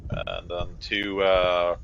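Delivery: ordinary delivery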